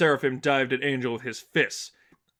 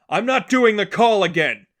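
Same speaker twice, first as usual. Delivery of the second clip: a loud, raised call over the fighting; 8 semitones higher, 9 dB louder than usual. A narrator's reading. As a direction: call it across the room, up high.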